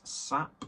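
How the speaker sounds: "Sap" drawn out with a popped final P; slow "Sap", its P fully released; over-pronounced P. Real, not acted. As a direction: really pronounce the P ending "Sap". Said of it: "Rarely pronounce my P's then".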